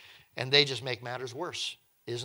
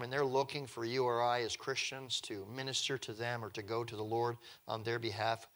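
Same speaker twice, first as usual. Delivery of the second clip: ordinary talk, nothing marked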